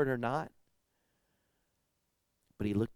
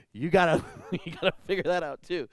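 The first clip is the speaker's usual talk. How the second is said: words broken by real laughter; chuckling through it; laughing; 6 semitones higher, 4 dB louder than usual. Real, not acted. Laughs while telling the kid.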